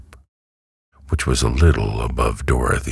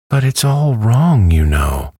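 high, louder earnest protest; 10 semitones higher, 5 dB louder than usual. A narrator's reading. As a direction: insist sincerely, little girl to the hen. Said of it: "declared Dorothy earnestly".

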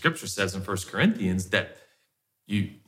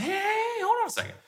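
much higher, playful concerned hey hey hey; mock alarmed husband; 3 dB quieter, faster than usual. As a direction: stop her mid-sentence in mock alarm. Husband stops wife, concerned.

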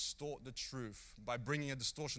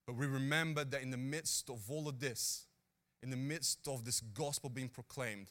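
usual speaking voice

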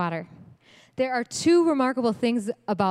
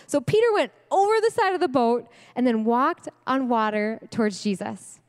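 ordinary talk

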